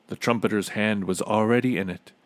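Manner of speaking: ordinary delivery, nothing marked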